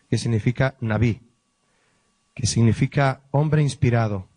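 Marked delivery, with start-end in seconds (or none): 0.8-1.2 "nabí" accented on its final syllable; stress on final I. Shown as a demonstration. Pastor spells out the Hebrew for prophet, "nabí" stressed on the final I. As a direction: say "nabí" with the weight on "bí". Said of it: "con acento en la I".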